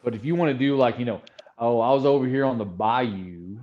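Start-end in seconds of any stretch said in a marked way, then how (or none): 1.6-3.6 Louisiana accent. Shown as a demonstration. Contrasting it with true Texan, Louisiana accent. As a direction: stretch the vowels like a bayou local. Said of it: "a Louisiana accent because they have elongated vowels".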